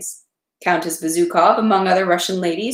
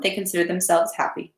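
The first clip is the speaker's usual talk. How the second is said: usual speaking voice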